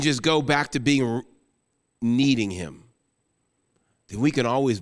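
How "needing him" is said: ordinary talk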